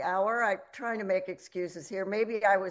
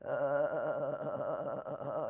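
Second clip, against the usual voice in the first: low creaky drawn-out uh; vocal fry; 5 semitones lower, 6 dB quieter than usual. Shown as a demonstration. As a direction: let the uh rattle low in the throat. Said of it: "let me demonstrate".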